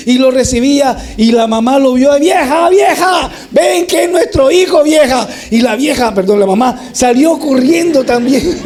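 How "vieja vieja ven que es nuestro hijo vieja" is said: high call across the yard. Acted. Father calls his wife to see their son, high excited call. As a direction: call your wife over, overjoyed.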